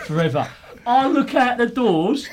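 usual speaking voice

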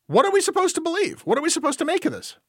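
high-pitched, rapid exasperated questions; mock bewildered pleading. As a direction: plead for answers in a high, hurried voice. Host playfully demands answers from co-host.

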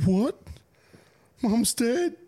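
very high, loud mock disbelief; hammed-up horrified phone reaction; fake shock. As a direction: squeal it like staged terrible news. Brother's faked shock on phone.

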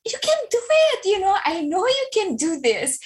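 mother urging her daughter, going shrill; high-pitched pushing. Retold or imitated. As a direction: push her like an overeager math coach. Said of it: "I was already high pitched".

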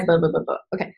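ordinary talk, nothing marked

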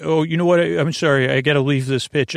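ordinary talk

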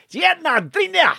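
high-pitched caricature of a Scottish drinker; thick Scottish accent. Retold or imitated. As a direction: squawk it in broad, barely intelligible Scots. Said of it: "had the same accent as the fella".

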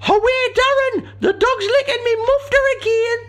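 very high, loud, slow falsetto shriek; shrieking woman voice. Acted. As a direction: screech it as a startled woman.

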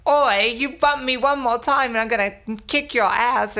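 brash cockney threat; British tough girl. Retold or imitated. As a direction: snap it like a tough London girl. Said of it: "and she's like".

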